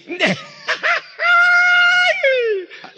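high, loud shrieking burst of laughter. Real, not acted. Hearing his friend's punchline, shrieking laugh. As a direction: let a huge laugh explode.

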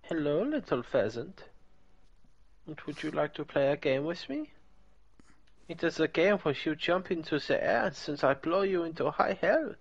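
put-on playful German villain accent. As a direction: coax the prey in a mock German accent. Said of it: "It's very clearly a German accent".

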